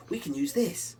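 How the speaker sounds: light up with the idea; excited Rollo. Acted. Rollo seizing the bird's plan, eager. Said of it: "says Rollo".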